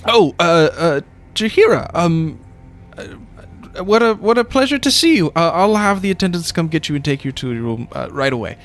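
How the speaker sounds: flustered high-pitched servant, stammering; a fussy servant caught off guard; nervous attendant voice. Acted. GM voicing a nervous NPC.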